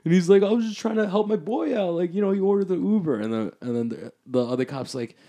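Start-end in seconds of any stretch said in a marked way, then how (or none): 0.4-3.2 high, quick imitation of a drunk friend explaining; pitched-up, softer retold plea; pleading excuse. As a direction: act out an innocent buddy's excuse. Friend pleading his excuse to cop.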